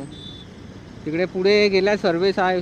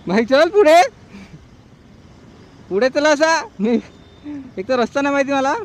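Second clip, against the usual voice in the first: shouting to a friend ahead, much higher and louder; calling out; 9 semitones higher, 8 dB louder than usual. Real, not acted. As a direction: yell across the road over traffic.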